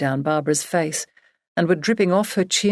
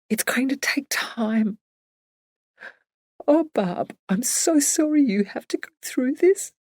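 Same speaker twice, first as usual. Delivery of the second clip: gentle crying; 8 semitones higher than usual. Acted. Emily crying with her grieving friend, tender. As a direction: let her own tears rise as she apologises. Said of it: "Emily's gentle crying became sobbing".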